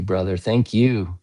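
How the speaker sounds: normal talking voice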